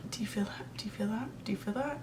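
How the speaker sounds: hushed, urgent whispered question; retold whisper. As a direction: whisper it as if replaying a spooked moment. Retold hushed exchange with friend.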